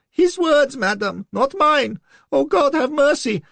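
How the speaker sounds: very high, loud squeal. A narrator's reading. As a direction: squeal in terror. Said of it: "His voice went up to a squeal".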